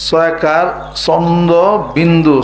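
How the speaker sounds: each part of চন্দ্র বিন্দু said slowly and deliberately; ধীরে ধীরে বানান; slow spelling out. Shown as a demonstration. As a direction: spell it out letter by letter. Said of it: "চাঁদ বানান কি".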